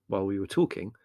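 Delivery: ordinary delivery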